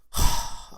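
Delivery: loud breathy sigh; heavy sigh. Real, not acted. Reviewer collecting himself before the spoilers, heavy sigh. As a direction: let out a big heavy sigh.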